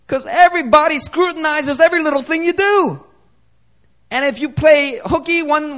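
high-pitched, driving preacher's voice; impassioned preaching. Acted. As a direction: preach it high and forceful, building in volume. Preaches at full force.

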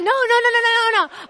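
high, loud rapid-fire refusals; panicked pleading, acted. Wife stopping her husband from coming down, high-pitched mock panic. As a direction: hurry to call him off, playing up the panic.

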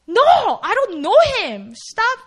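loud, high, emphatic protest; upset denial. Acted. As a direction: deny it heatedly, voice jumping up. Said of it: "getting really upset and denying".

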